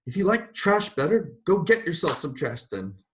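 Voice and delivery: high, loud railing. A narrator's reading. Mrs. Turpin railing at God, scornful. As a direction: lash out in scorn. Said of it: "she railed".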